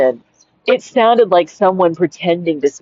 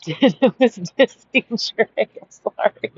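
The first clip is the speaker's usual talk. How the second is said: soft, slow words squeezed through laughter; trying to talk while cracking up; laughing helplessly; 5 semitones higher, 5 dB quieter, slower than usual. Real, not acted. Speaker recalling a rap verse to listeners, laughing too hard to talk.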